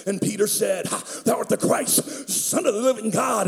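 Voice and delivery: mock preacher, slow and drawn out; dramatic slow preacher impression; imitating a revival preacher. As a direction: ham up a camp-meeting preacher. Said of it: "I've seen preachers get up and say".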